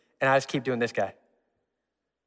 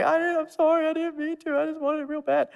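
put-on much higher, strained little-boy voice, sobbing out sorry; crying child apology; 12 semitones higher than usual. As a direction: beg forgiveness like a bawling six-year-old. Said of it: "I'm crying my eyes out".

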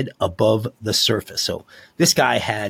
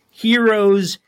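hyped announcement; 8 semitones higher, 4 dB louder than usual. Acted. Hyping the cops' award.

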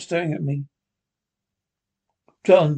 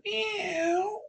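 much higher, slow drawn-out meow; cat meow; 7 dB quieter than usual. Acted. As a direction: mew like a cat. Linda the cat.

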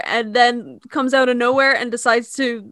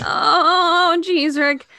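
Morty voice; 5 semitones higher, much slower than usual. Retold or imitated. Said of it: "a nasally version of my Morty".

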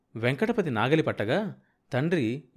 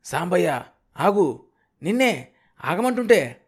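loud, high hailing shout; calling out; 6 semitones higher, 6 dB louder than usual. A narrator's reading. Kanakayya hailing Sambayya from afar, shouting urgently. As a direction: holler after someone to make them stop. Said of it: "Kanakaiah పైపంచ విసురుతూ కేకపెట్టాడు".

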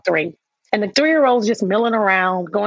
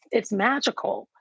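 normal talking voice

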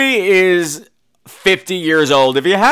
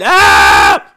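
loud horror-movie scream; big hammed-up shriek; mock scream; 8 dB louder than usual. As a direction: scream at full force. Mimics mother's scream.

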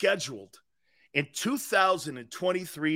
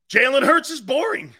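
loud declaration; 6 semitones higher, 8 dB louder than usual. Real, not acted. Emphatic declaration.

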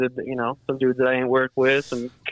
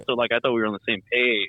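normal talking voice

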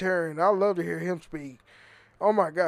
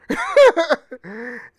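loud laugh; 8 semitones higher, 8 dB louder than usual. Real, not acted. Laughs at news remark.

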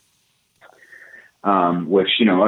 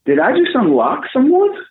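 very high, squeaky amazed voice; hammed-up falsetto surprise; mock amazement; 3 dB louder than usual. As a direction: act shocked at your own discovery. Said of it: "And I was like".

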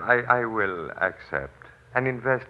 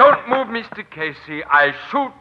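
much higher, loud warning shout; shouted threat; 9 semitones higher, 8 dB louder than usual. Acted. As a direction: yell to stop him cold. Swami threatens to shoot.

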